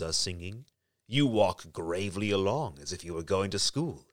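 low, coaxing wolf. A narrator's reading. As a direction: lure her gently like a sly wolf. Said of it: "then he said".

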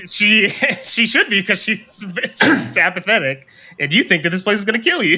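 giggling while explaining, words quickening at the end; can't keep a straight face; laughing through it. Real, not acted. DM explaining to the players, laughing through the words.

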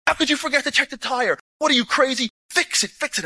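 high-pitched, exasperated mock yell; retold yelling. Officer to his team by the broken jeep, imitated angry yelling. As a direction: scold them urgently, pitched well up. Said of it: "He's yelling at his people".

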